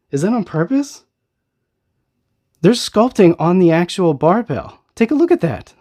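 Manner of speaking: high-pitched disbelief rising to a squeak; real amazement. Real, not acted. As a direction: marvel at a surprise find, voice climbing high. Discovers unexpected sculpting on toy.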